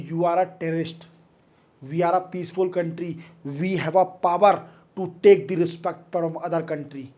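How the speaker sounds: English words spaced out slowly; slow dictation. Shown as a demonstration. Teacher to students copying notes, slow English dictation.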